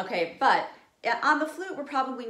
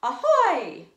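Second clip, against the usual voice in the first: loud raised pirate call; pirate ahoy; 8 semitones higher, 12 dB louder than usual. Shown as a demonstration. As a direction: bellow it from the crow's nest. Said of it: "let's do ahoy also".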